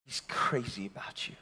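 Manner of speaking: intimate whisper; whispered tenderly. Real, not acted. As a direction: lean in and whisper it. Intimate sermon point.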